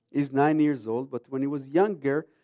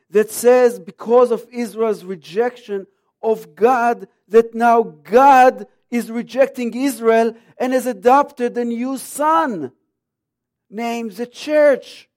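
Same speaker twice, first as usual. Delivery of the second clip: preaching; 6 semitones higher, 9 dB louder, slower than usual. Real, not acted. Preaching against false teaching.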